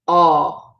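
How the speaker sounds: "aw" held long and open; long aw sound. Shown as a demonstration. Teacher modelling the A with fada, open aw. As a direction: sound out "aw" alone. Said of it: "A W sound".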